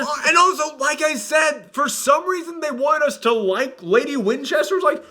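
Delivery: high-pitched, incredulous rant; exasperated. Real, not acted. Reviewer baffled they want us to like Lady Winchester, exasperated. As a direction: protest in baffled disbelief.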